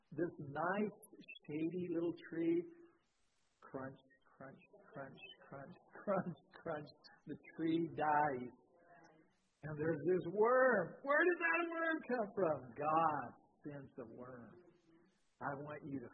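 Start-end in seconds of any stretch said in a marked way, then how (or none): 3.6-6.9 soft hushed crunch sounds; worm munching. Retold or imitated. Preacher mimics the worm eating the shade tree, hushed. 11.0-12.7 higher, slow mock amazement; mock surprise. Preacher asks the congregation, mock surprised.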